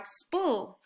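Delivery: normal talking voice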